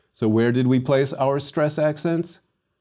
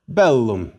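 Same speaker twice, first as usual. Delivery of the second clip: bellum as BEL-lum; first-syllable stress; 4 dB louder than usual. Shown as a demonstration. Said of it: "First syllable".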